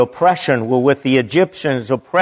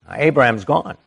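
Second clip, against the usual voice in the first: ordinary delivery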